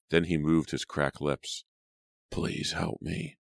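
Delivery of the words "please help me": faint, slow, whispered plea; barely able to speak; weak voice. A narrator's reading. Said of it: "he pleaded in a weak voice".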